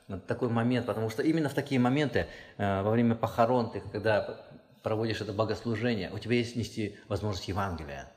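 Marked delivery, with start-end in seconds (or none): none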